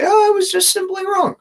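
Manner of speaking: mock them in a silly high squeak; mocking falsetto. Mocking the website's admission.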